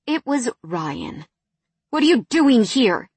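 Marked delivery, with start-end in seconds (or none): 1.9-3.1 angry question, higher and louder; hissed. A narrator's reading. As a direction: hiss it at him angrily. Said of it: "I hissed".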